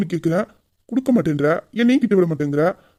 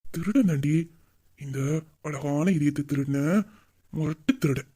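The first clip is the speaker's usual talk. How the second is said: low, soft, slow romancing; quiet, smooth sweet talk; flirtatious; 6 semitones lower, 6 dB quieter than usual. Acted. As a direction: linger tenderly on each phrase. Flirting back as heart thief.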